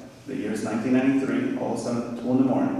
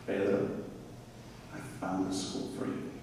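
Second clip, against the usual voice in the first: soft, low voice, trailing quieter; gentle, hushed; 5 semitones lower, 13 dB quieter than usual. Retold or imitated. Mom telling her son she found him a school, gentle and hushed. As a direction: say it softly, with pauses, as a retold mom. Mother gently breaking good news.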